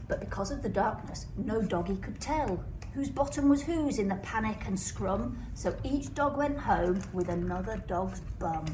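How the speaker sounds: ordinary delivery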